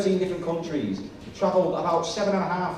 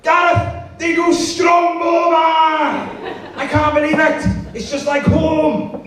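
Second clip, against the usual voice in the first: high, drawn-out shout across the bar; hammed-up Welsh tourist yelling; excited Welshman; 14 semitones higher, 9 dB louder, slower than usual. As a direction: bellow to a mate in thrilled disbelief. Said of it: "You're a Welshman in an Irish bar".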